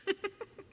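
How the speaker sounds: very high string of giggles; giggling. Real, not acted. Streamer after asking viewer about thighs, giggling.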